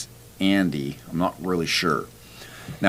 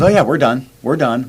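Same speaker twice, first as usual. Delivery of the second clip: body giving up; 7 semitones higher, 7 dB louder than usual. Retold or imitated.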